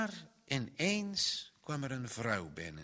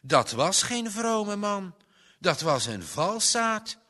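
put-on loud, high indignant child; 8 semitones higher, 8 dB louder than usual. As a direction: condemn the man outright. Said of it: "riep Johannes verontwaardigd".

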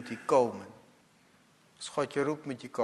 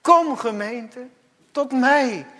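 high, loud, slow calling voice; God calling; 8 semitones higher, 9 dB louder than usual. Acted. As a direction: call her home from far away. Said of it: "zegt de Heer". (de Heer die roept, luid)

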